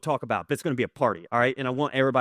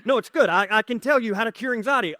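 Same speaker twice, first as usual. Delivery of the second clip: higher, quick, breezy fake confidence; hammed-up calm over nerves; mock bravado; 9 semitones higher, 4 dB louder than usual. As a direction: brush it off like nothing's wrong. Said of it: "I'm like".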